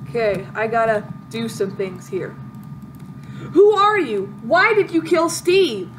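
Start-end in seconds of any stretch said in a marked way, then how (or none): 3.5-5.9 high, loud heroic demand; dramatic outraged yell. Pretend. Hero confronting Steve's killer, outraged yell. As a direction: shout it like a wronged avenger.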